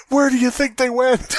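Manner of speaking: high, mock-desperate wondering; overwrought fan. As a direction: ask it like an obsessed fanboy. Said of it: "He turns to you and he goes".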